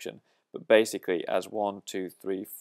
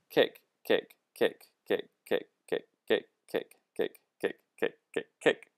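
"kick" repeated in short, evenly spaced beats; rhythmic count-out. Shown as a demonstration. Drum teacher pointing out the kicks, rhythmic count-out. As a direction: tap out each "kick" like a steady drum pulse.

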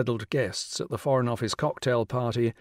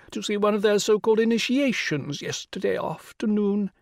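rich royal voice; 8 semitones higher than usual. Acted. The Rani greeting Will on the phone, rich and regal. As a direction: sound like a queen condescending down the phone. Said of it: "the rich royal voice".

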